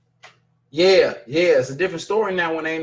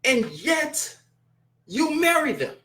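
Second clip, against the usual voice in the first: incredulous sarcasm; 8 semitones higher, slower than usual. Real, not acted. Mocking the couple's choice.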